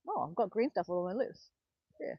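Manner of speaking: usual speaking voice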